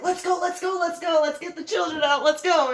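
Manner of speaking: loud, high hurrying; urgent hurry. Retold or imitated. Hurrying children off the teacup ride, urgent. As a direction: rush the kids along.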